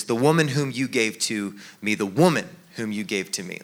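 "woman" leaned on, pointing blame at her; accusing emphasis on "woman"; stress on WOMAN. Shown as a demonstration. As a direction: hit the word "woman", let the rest drop. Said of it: "how you emphasize which word".

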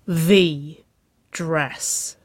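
THE drawn out and punched, then a pause before dress; awed emphasis. A narrator's reading. Narrator dwelling on the girlfriend's dress, awed emphasis.

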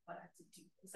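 unvoiced mutter of a sulky kid; whispering under breath. Mom imitating daughter grumbling under her breath. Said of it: "she'll say".

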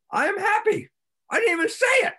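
high, bright burst of joy; surprised delight. Retold or imitated. Acting out the person waking up happy, high and elated. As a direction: squeal it out like a happy discovery.

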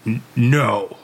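halting, imitated wrestler denial; Hulk Hogan. Wrestler answers the lie detector, halting impression. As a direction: stammer a mock wrestler no. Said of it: "He goes".

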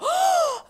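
loud, high imitated gasp; sharp gasp mimicking a startled crowd; collective shocked gasp, imitated. Student imitates everyone seeing her fall, shocked gasp. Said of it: "I just hear everyone go".

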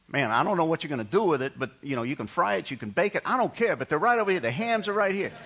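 high, exasperated counterman, starting fast; rude New Yorker. Retold or imitated. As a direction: imitate an impatient clerk, pitch climbing as he insists. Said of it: "she thought he was rude".

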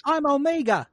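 high, laughing Kiwi impression; mock New Zealand accent. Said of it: "sounded too much like Korg".

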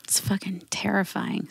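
unvoiced whisper of dread. Real, not acted. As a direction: breathe it out scared. Reacting to scary baby-making.

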